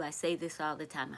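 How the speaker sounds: usual speaking voice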